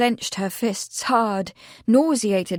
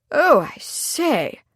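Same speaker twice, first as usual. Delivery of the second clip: high, drawn-out mild protest; 6 semitones higher, much slower than usual. A narrator's reading. The vicar objects to talk of hanging, protesting. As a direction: object slowly, pitch swooping high and down. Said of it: "the vicar protested".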